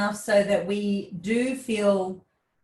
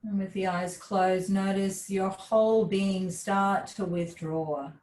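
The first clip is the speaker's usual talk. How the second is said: guided relaxation; 2 semitones lower, 3 dB quieter than usual. Real, not acted. Yoga teacher guides class with eyes closed, soft guided relaxation. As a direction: ease them inward, calm and unhurried.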